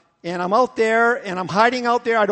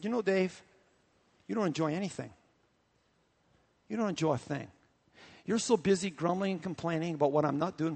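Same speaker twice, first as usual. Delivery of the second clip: soft gentle rebuke; 3 semitones lower, 7 dB quieter than usual. Acted. Holy Spirit gently rebukes pastor.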